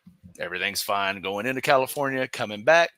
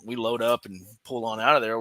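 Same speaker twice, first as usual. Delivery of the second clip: ordinary talk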